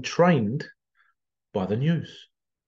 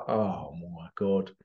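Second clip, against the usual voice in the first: weary dismay; 7 semitones lower, 7 dB quieter, slower than usual. Retold or imitated. Reacting to the decorations.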